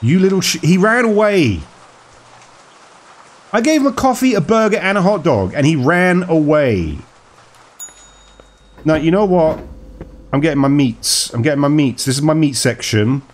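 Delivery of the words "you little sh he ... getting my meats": high-pitched, indignant protest; indignant outrage. Real, not acted. Player complains that the customer fled with the food, high indignant outrage. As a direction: start high in disbelief, drawing out the last words. Customer runs off without paying.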